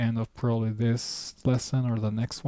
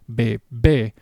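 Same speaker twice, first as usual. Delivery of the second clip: bebé with more force on the last "bé"; stress on final syllable; 3 dB louder than usual. Shown as a demonstration. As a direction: push the second syllable of bebé. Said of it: "a little bit more force".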